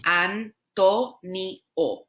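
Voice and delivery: very slow, Antonio with short O's; Spanish pronunciation. Shown as a demonstration. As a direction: Antonio as ahn-TOH-nyoh. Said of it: "Your O's have to be shorter".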